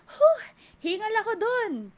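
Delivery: high gasping huff, winded after a few stairs; out of breath. Shown as a demonstration. Trainer acts out a winded stair climber, gasping breathlessly. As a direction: act out being winded, a big gasp then panting words. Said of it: "naglakad lang ng ilang steps sa hagdan".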